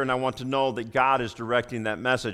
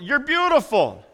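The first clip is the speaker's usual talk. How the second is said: smiling; 9 semitones higher, 4 dB louder than usual. Shown as a demonstration. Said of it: "can't tell somebody they're beautiful and not smile".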